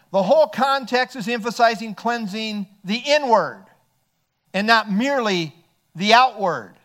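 normal talking voice

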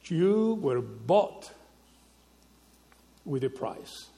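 slow emphasis. Shown as a demonstration. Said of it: "believers as saying that".

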